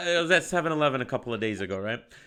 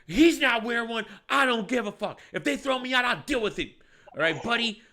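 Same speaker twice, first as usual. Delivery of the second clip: raised pitch, mildly heated; retold defiant retort; 7 semitones higher than usual. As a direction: imitate a guy arguing back. Acted customer's defiant retort.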